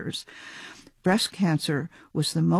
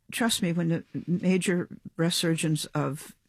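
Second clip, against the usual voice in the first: ordinary talk, nothing marked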